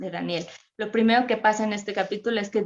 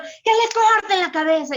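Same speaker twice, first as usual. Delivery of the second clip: loud, much higher furious command; angry king shouting; 11 semitones higher, 5 dB louder than usual. Retold or imitated. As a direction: order the beheading in a rage. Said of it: "así era Nabucodonosor". (Nabucodonosor gritando con furia)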